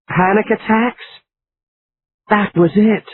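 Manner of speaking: eureka, she's found it; a bright burst of discovery; sudden realization. A narrator's reading. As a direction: pitch jumps up as the idea lands. Laura hits on the idea.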